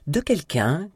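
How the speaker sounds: high-pitched, suspended opening; starting a revelation. A narrator's reading. Kit starts exposing his sister's affair, suspended revelation. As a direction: begin an accusation, left hanging. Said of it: "commença Kit".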